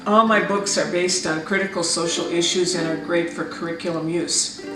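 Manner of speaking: usual speaking voice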